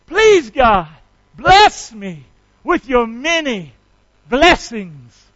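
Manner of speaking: loud, slow prayer line to repeat; leading a prayer. Shown as a demonstration. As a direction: draw each word out for the congregation. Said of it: "start praying like this".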